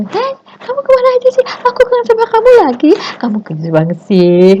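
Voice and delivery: mock clingy excess; overdone gushing. Shown as a demonstration. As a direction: gush like you can't contain yourself. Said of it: "kita berlebihan".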